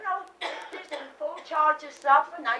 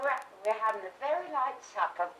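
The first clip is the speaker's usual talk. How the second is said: ordinary talk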